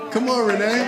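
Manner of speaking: loud, high playful call; teasing call-out. Real, not acted. Panelist calling out playfully to absent friend Renee, loud and high. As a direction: call out to her across the room with a grin.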